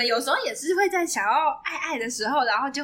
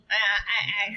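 duck voice; 4 semitones lower, much faster than usual. Retold or imitated. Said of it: "就用那种很鸭子音".

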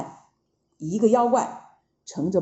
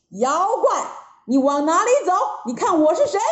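challenging call; 6 semitones higher, 3 dB louder, much faster than usual. A narrator's reading. Wukong calls out to monster.

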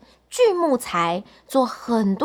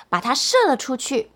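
ordinary delivery

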